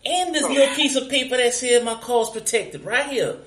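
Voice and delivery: high-pitched, eager-to-please put-on voice; mock servile driver. Driver showing papers to police, mock servile and high. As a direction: play overly obliging to an officer. Trying out the coached servile act.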